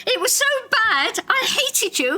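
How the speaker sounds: high mock-outraged Irish chef, fast at the end; a lightly done Irish accent pitched high, mock indignant. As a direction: play the chef who grew up hating her on the radio. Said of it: "he had an Irish accent".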